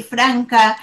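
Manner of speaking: ordinary talk, nothing marked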